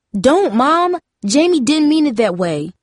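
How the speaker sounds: high, alarmed appeal to a mother; urgent plea. A narrator's reading. Darcy intervenes to stop Mom.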